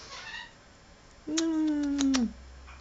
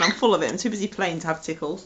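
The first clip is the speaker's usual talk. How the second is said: voicing the pet; 12 semitones lower, 4 dB quieter, faster than usual. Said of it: "No, he said".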